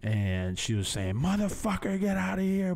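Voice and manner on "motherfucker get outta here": high-pitched retold yell, no real volume; mock screamed cussing. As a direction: imitate grandma cursing someone out, pitched up but held back. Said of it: "she just started screaming, like, cussing".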